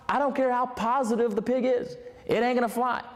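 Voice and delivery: ordinary delivery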